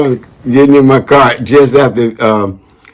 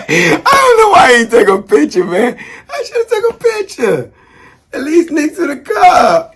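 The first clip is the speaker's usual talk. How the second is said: words squeezed out through high laughter; laughing. Real, not acted. Regretting no photo of the car, high cracking laughter.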